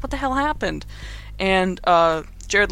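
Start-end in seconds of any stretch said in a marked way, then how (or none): 0.0-0.8 mock shocked. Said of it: "and he's like".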